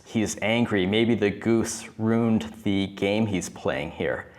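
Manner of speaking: usual speaking voice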